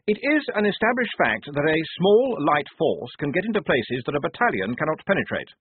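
addressing the men with eager self-importance; the lieutenant briefing his squad; earnest young officer. A narrator's reading. Blouse lecturing on tactics.

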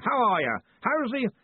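high, hearty yell. A narrator's reading. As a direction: shout small talk over a great distance. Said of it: "yelled Ridcully".

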